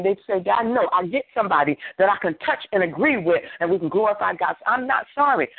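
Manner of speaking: fervent praise prayer. Real, not acted. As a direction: pour out thanks to God in rhythmic phrases. Leads fervent praise prayer by example.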